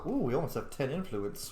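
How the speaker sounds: normal talking voice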